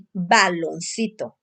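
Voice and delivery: ordinary talk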